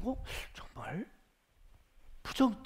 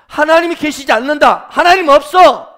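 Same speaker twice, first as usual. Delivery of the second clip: high, booming denial; forceful declaration; 7 semitones higher, 18 dB louder than usual. Acted. Preacher shows the devil's unused blunt denial, shouted declaration. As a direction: proclaim it at full volume.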